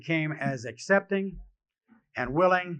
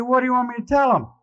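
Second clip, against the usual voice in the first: higher-pitched, louder comic protest; mock incredulous; 6 semitones higher, 5 dB louder than usual. Sick patient asked to advise a newcomer, retold in mock incredulity. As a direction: play up the disbelief, pitched high. Sick man mocks his own example.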